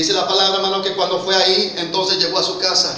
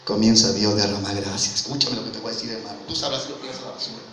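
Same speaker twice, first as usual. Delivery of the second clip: much lower and softer; hushed aside; 8 semitones lower, 10 dB quieter, faster than usual. Real, not acted. Preacher confiding God's grace to a brother, hushed aside. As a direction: drop to a quiet, confiding preacher's voice.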